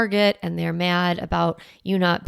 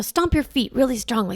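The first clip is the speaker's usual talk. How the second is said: ordinary talk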